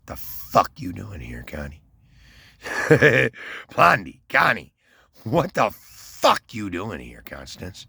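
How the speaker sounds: a mock snarl at his ex, half-acted; retold confrontation. Man confronting his ex at the bus station, mock angry. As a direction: act out catching your ex on the wrong bus.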